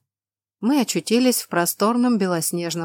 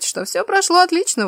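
much higher, mocking lilt; high sly voice; 11 semitones higher, 5 dB louder than usual. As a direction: sweeten it up high. Tamash speaks behind king.

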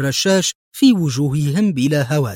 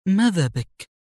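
higher-pitched bewildered question; puzzled; 6 semitones higher than usual. A narrator's reading. Woman puzzled by the man's stern look, bewildered. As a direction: ask him in mild confusion. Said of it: "فسألته في حيرة".

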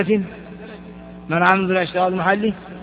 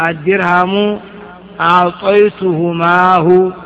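أعطيتهماه drawn out syllable by syllable; slow example; slower than usual. Shown as a demonstration. Teacher spelling out the example slowly. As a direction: stretch the word so students hear it. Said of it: "teacher gives example".

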